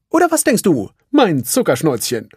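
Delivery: high, cheerful little handyman; Felix's voice. Acted. Felix tells Ralf about the new game, chipper. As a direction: chirp it out, eager and quick. Felix asks his wife.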